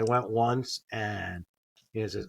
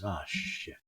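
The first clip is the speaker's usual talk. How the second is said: muttered curse; 6 semitones lower, 8 dB quieter than usual. Retold or imitated. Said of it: "Like that".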